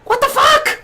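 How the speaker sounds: shrill high panicked yelling manager; a little man losing it, yelling way up high; GM voicing a panicked manager. Manager bursting out of his wagon, yelling in alarm. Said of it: "and goes".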